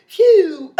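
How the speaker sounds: loud relieved phew into a laugh. Retold or imitated. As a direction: blow out the relief, then laugh. Relief after mom goes inside.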